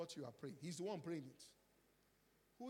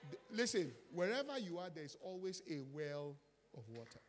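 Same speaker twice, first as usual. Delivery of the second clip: much louder and higher, rousing; preaching at full force; 3 semitones higher, 10 dB louder than usual. Acted. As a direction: fire up the congregation.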